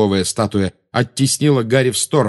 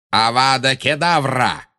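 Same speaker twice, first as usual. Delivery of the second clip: drawn-out, higher-pitched spell cry; incantation; 4 semitones higher, slower than usual. A narrator's reading. Villain casting the killing curse, incanting. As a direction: cast it slowly and forcefully.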